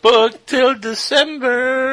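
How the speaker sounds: slow, high drawn-out echo; mock excited. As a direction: stretch it out in a high voice. Echoing co-host, drawn out.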